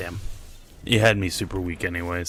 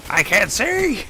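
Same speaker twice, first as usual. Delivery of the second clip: being chased; 12 semitones higher, 3 dB louder, slower than usual. Real, not acted. Blinded in game.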